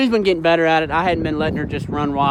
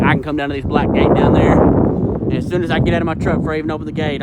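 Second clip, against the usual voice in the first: normal talking voice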